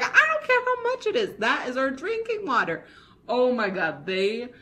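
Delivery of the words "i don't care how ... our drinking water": high, fast outraged scolding; outraged villagers. Retold or imitated. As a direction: scold him for dirtying the water. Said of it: "They were like".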